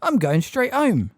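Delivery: a hint of a flustered old neighbour; Miss Fig. A narrator's reading.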